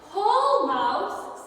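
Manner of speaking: loud, high urging pull; urgent call. Acted. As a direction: urge Mouse to pull hard. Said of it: "said Bear".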